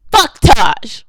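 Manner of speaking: loud shouted curse; furious shout. Real, not acted. Cursing the director who ignored her, in a furious shout. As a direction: spit the name out in a real shout.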